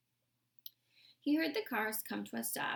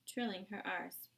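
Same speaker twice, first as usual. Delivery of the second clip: usual speaking voice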